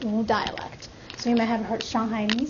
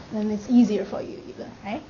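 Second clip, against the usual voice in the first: usual speaking voice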